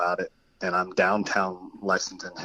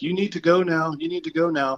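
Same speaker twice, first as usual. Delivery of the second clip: raised-pitch, uneasy insistence; retold nervous urging; 8 semitones higher than usual. Sending the strange woman away, retold nervous urging. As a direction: urge them away in a high, uneasy voice.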